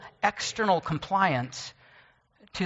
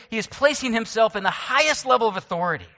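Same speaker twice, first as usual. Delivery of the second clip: usual speaking voice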